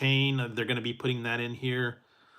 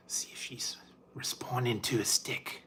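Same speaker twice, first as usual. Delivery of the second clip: whispered wildlife-host aside; mock documentary whisper; 7 dB quieter than usual. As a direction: breathe it like a hushed nature documentary. Hushed nature-host aside.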